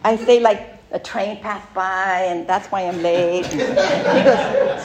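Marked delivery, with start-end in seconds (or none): 3.5-4.8 laughing. Real, not acted.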